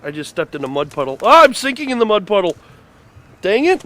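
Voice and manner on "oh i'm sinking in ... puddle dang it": dismayed. Real, not acted. Stepped in mud puddle.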